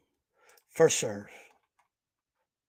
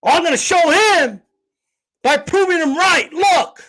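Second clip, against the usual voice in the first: mocking the troll; 6 semitones higher, 3 dB louder than usual. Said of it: "and he thinks he's owning me".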